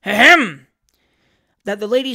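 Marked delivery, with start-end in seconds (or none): 0.0-0.7 booming, exaggerated throat-clearing; very loud ahem. A narrator's reading. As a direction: call out a loud "ahem" to be noticed. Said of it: "called out very loudly".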